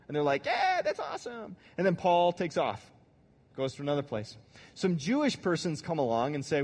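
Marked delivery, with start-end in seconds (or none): 0.5-1.6 higher, gushing "yeah"; mock excited. New Galatian converts cheering Paul's good news, excitedly. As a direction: imitate the converts' delight.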